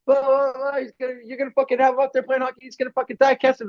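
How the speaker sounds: high, mock-frantic mother scolding; mocking mom voice. Son retells mom yelling at his friend, high mocking voice. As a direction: squeal it like a panicked mom.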